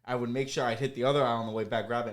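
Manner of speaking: ordinary talk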